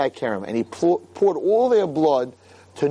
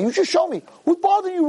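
high-pitched mock tough guy; emboldened drunk; 14 semitones higher, faster than usual. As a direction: imitate a tipsy follower spoiling for a fight. Imitating emboldened drunk men to rabbi.

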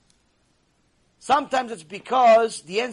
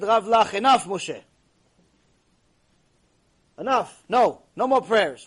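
loud, emphatic cutting-off; firm refusal; 6 dB louder, slower than usual. Retold or imitated. Hashem stops Moshe's endless prayers, firm raised voice. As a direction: put your foot down, punching each word.

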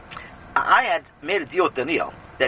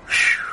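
breathy sigh of a phew; long exhaled phew; 4 dB louder than usual. Acted. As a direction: blow out a heavy breath. Relieved or weary Rebbe exhales.

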